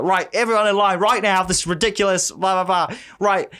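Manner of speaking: hammed-up teacher telling kids off; imitating angry teacher. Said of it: "she's like".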